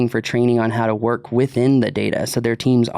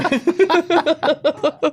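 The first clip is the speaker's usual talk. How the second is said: a high, real laugh. Real, not acted. Laughing at own idea of a kids catio project. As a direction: laugh at your own joke.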